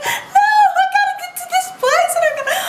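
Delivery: high, loud half-crying protest; mock panicked wail. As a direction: wail it like a panicked lost tourist. Retold panicked crying refusal.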